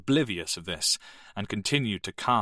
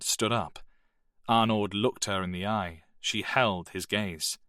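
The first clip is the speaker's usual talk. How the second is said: plain reading voice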